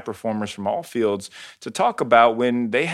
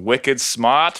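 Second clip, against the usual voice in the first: slow Boston drawl, no R in smart; Boston accent; 2 semitones higher than usual. Pretend. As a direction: stretch out Wicked Smart like a Bostonian. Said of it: "You actually said that perfectly without the R".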